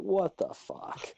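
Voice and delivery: slow, high, hushed what-the-fuck; retold disbelief. Telling friend how the cat flew over, drawn-out disbelief. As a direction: draw it out high and soft in disbelief. Retold baffled reaction to cat's leap.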